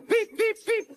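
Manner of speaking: very high engine whistle; whistling beeps. A narrator's reading. Percy signals the guard, very high whistle. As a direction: pipe three short, high notes. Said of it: "whistled Percy".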